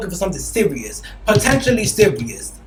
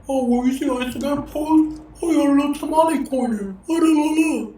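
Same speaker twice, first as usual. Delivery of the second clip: higher, sing-song mocking impression of him; 8 semitones higher than usual. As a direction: tease him with a prissy voice. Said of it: "I'm not making fun of him".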